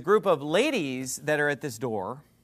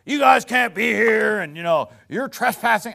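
mock angry yell; 4 semitones higher, 6 dB louder than usual. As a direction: bark it out like the angry neighbor. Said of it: "he's yelling at these ladies".

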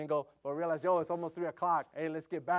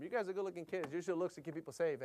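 joking; 7 dB quieter than usual. Pretend. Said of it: "sometimes joke around with them".

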